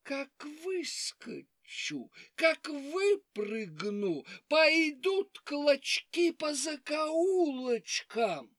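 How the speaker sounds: slow drawn-out threat; menacing fox. Acted. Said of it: "лиса закричала на собак".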